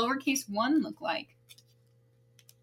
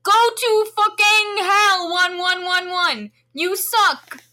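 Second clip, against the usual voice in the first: loud, slow dramatic yell; shouting; 6 semitones higher, 5 dB louder, slower than usual. Acted. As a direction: belt it out in fury. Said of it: "you got so much louder".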